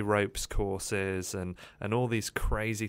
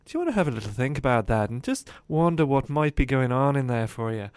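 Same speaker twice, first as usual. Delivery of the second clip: put-on Irish accent; 4 semitones higher, 7 dB louder than usual. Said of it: "he would become more Irish".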